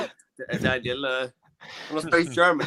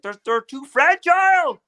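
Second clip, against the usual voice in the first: higher, louder pundit voice, mock-lecturing; hammed-up pundit lecture; Jordan Peterson impression; 7 semitones higher, 4 dB louder than usual. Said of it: "He's like".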